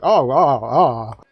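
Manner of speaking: loud, hammed-up oohs and aahs; mock moans. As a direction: moan loudly as a joke. Spirits walk in on bedroom scene.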